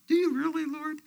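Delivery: doubting believer asking God, awed; surprised question. Retold or imitated. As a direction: ask as if you can hardly believe it. His own reply to God.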